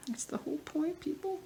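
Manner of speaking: playful high-pitched scolding of viewers; mock exasperated. Real, not acted. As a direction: tease the audience with a high, put-upon voice.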